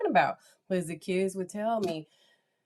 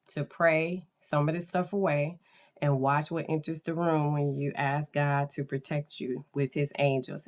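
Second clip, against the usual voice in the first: ordinary talk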